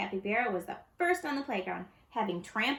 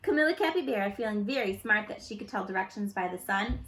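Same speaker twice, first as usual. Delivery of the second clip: ordinary delivery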